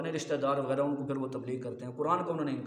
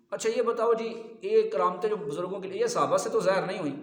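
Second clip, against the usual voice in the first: higher, louder mock of the critics' challenge; 5 semitones higher, 6 dB louder than usual. Preacher mimicking critics challenging him, higher and louder. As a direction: mimic a smug questioner, raised up high. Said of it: "وہ کہتے ہیں".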